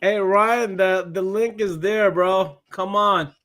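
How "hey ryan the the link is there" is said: usual speaking voice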